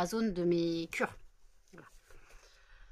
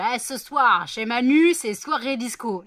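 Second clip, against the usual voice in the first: louder, playful announcer-like line; joking quote; 3 semitones higher, 9 dB louder, slower than usual. Retold or imitated. Joking to Emmanuel about a disco night, a louder playful quote. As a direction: announce it as a joke. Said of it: "pour déconner, je fais".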